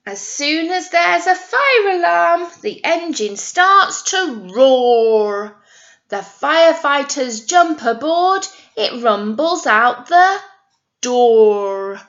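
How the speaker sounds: loud, dramatic storybook read-aloud; reading to children. Acted. Teacher reading the fire engine book aloud, loud and dramatic. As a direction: boom out the rhyme with excitement.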